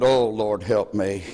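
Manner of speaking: low, fading plea to God; muttered prayer. Retold or imitated. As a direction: sigh a small prayer downward. Prayerful aside in dismay.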